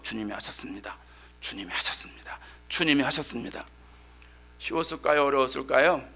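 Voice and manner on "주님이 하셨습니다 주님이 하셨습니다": soft low whisper to oneself; said inwardly. Retold or imitated. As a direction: murmur it under the breath. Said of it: "속으로 열면서". (속으로 조용히 되뇌는 고백)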